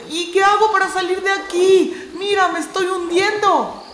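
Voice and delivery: pleading panic. Acted. Core begging Tayo while sinking, high and panicked. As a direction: beg for a way out.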